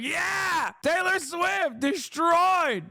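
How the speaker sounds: very high, slow shouted cheer; mock gleeful shout. Streamer mocks Megyn Kelly cheering, very high and slow shouting. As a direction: squeal it out like a giddy fan. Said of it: "She's like".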